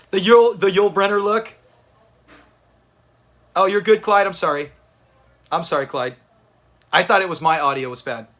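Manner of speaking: normal talking voice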